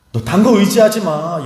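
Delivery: crying out. Acted. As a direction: call it out with aching force. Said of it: "십자가 위에서 외치시는 거예요".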